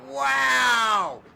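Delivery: loud, high amazed wow; excited wow. Real, not acted. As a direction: gasp out the wow. Reaction to close finish.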